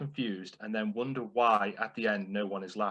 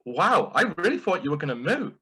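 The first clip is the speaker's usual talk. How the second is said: mock surprised cow; 7 semitones higher, 4 dB louder than usual. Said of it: "the other cow goes".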